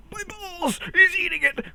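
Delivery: very high hammed-up squeal; mock squeal. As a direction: squeal it in comic alarm, way up high. Acts out comic squealing alarm.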